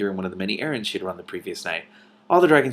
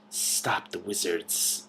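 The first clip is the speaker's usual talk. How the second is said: slow, very soft hissing whisper; 7 dB quieter, much slower than usual. A narrator's reading. Hidden snake urging the friends on, slow whisper. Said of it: "whispered the soft voice".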